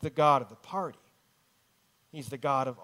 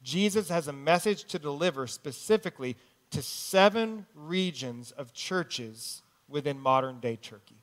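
usual speaking voice

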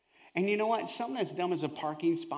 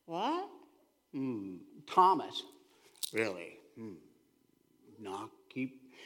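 hushed, slow confused disciple; a hint of a baffled Peter; Peter puzzled; 11 semitones lower, 6 dB quieter, slower than usual. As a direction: mumble it like you still don't get it. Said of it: "I can imagine Petey".

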